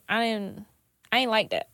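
usual speaking voice

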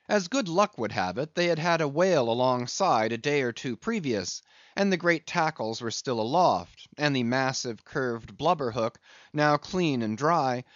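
normal talking voice

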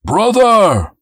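loud, high call across the street; an angel calls to his fallen brother. Acted. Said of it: "he called out".

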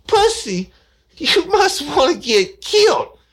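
high, loud and slow outrage; outraged old lady. Retold or imitated. Woman on the phone threatening the rude caller, loud and outraged. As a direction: spit it out, scandalized.

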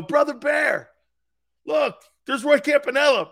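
loud, high retold call; excited pointing out. Pals pointing out the player to grandfather, excited shout. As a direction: shout it proudly to a pal. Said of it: "and they go".

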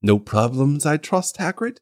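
put-on gentle old wizard. Dumbledore, quiet and gentle.

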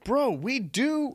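high, very drawn-out protest; exasperated mock pleading; retold exasperation. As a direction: stretch each word in disbelief at the cop. Retold exasperated reply to cop.

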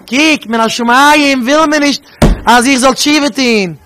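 high, loud and slow, pleading the words; rebbe crying out. Retold or imitated. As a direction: cry it out high like a rebbe begging. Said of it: "Und er sagt".

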